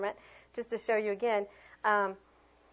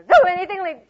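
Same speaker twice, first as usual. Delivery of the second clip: much higher and louder, animated; lively and varied; 8 semitones higher, 13 dB louder than usual. Shown as a demonstration. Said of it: "when I go high and low".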